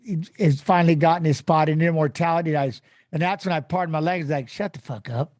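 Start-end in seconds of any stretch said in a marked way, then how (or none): 4.5-5.3 1 stunned disbelief. Retold or imitated. Retold stunned reaction to induction.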